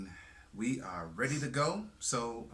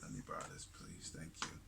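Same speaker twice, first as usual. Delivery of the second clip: quiet aside; 12 semitones lower, 11 dB quieter than usual. Real, not acted. Aside away from mic.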